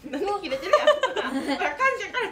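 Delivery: loud laughing retort. Real, not acted. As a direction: burst out laughing mid-sentence. Teasing friend's sudden mood swing.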